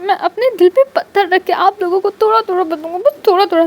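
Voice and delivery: put-on high, put-upon child voice giving in reluctantly; grudging child voice. Wing reluctantly sharing soup with Lan Chen, high grudging child voice. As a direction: sigh it out like a sulky child parting with a treat.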